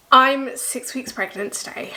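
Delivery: ordinary delivery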